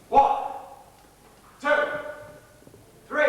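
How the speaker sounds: loud, high, spaced-out count; threatening countdown. Acted. Gunman counting down to firing, loud count. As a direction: call each number out hard, pausing between.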